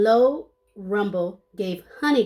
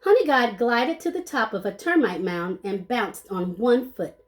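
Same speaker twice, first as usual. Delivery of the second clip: ordinary delivery, nothing marked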